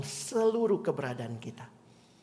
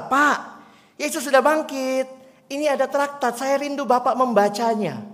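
put-on higher and louder, earnest young girl; eager girl; 9 semitones higher, 7 dB louder than usual. Girl approaches the old man with her tract, earnest.

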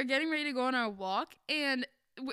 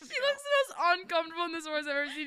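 usual speaking voice

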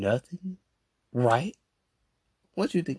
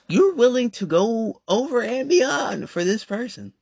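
1 emphatic high voice; 11 semitones higher, 3 dB louder than usual. Acted.

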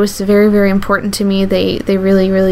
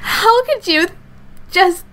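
crying; 9 semitones higher than usual. Real, not acted. Telling her mother she won't walk with her abuser, crying. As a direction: voice breaking high as she pleads through tears. Pleading with mom, breaking down.

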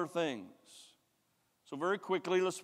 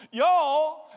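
loud high Southern "Y'all"; big Southern "Y'all"; 12 semitones higher, 13 dB louder than usual. Retold or imitated. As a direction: call out "Y'all" with a Southern drawl. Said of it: "he's Southern".

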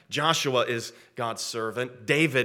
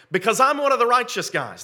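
louder, higher, mock self-righteous claim; self-righteous boast; 9 semitones higher, 8 dB louder than usual. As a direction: parade your righteousness loudly. Voices a wrongly self-righteous prayer.

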